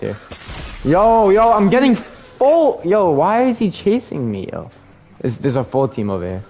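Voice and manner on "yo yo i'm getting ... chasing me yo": high, loud, panicked yelling; freaking out while being chased; panicking gamer. Real, not acted. Being chased by enemy team.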